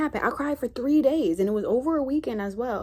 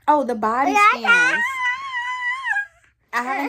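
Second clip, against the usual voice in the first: laughing; 7 dB louder than usual. Real, not acted. Remembers topic while laughing.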